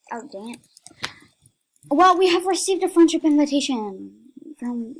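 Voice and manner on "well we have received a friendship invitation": fast. Real, not acted. Reads notice fast.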